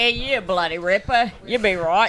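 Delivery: put-on an American hamming up an Aussie accent; mock Australian accent. American host shows off his Australian accent, hammed up. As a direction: throw out a bad Australian accent for laughs. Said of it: "have you not heard mine".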